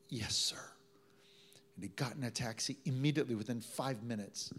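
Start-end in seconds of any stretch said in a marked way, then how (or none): 0.1-0.6 soft whispered reply. Retold or imitated. Obedient reply retold.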